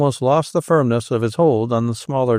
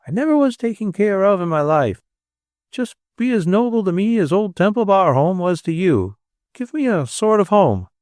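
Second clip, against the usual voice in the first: wheedling; 7 semitones higher than usual. A narrator's reading. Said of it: "with a wheedling smile".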